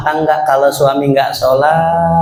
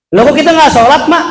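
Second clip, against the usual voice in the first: put-on higher, louder puzzled child buffalo; surprised calf; 12 semitones higher, 5 dB louder, faster than usual. Calf asking mother buffalo, higher and louder. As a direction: blurt it in surprise. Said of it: "kata anak kebo".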